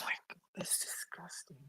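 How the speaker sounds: hushed, appalled aside; disgusted whisper. Real, not acted. Whispering in disgust at the mother's post.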